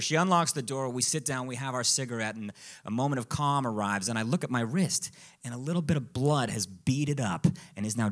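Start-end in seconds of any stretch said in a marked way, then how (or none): none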